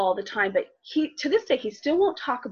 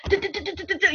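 imitated footsteps; 7 semitones higher than usual. Imitating child's footsteps down hall.

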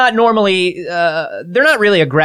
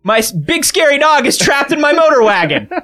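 higher dim-witted plea for help; idiot in trouble; 7 semitones higher than usual. Acted. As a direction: play dumb and scared, calling out. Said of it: "The dumber you can sound while you're scared".

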